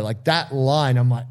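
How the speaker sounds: normal talking voice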